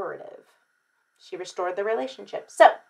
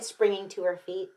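plain reading voice

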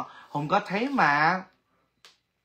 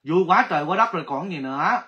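usual speaking voice

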